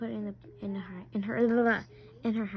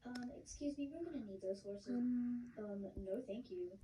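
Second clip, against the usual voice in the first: quiet aside; 14 dB quieter than usual. Pretend. Quiet aside to shop worker.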